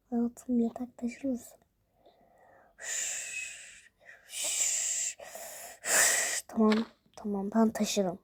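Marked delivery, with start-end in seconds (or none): 0.1-1.6 whispered request. Acted. Not waking the sleeper. 2.8-6.4 shushing. Acted. Shushing a friend.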